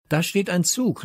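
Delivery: high-pitched excited call. A narrator's reading. Leo spotting a train for his friends, calling out. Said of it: "rief Leo".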